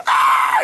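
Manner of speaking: short, very high loud scream; piercing acted yelp; mock shriek. Acting out second fright.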